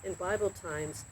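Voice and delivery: lively storybook reading with voiced characters; reading to children. A narrator's reading. Narrator reading a Bible story to children, lively. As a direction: read the Job story to kids like a bedtime tale.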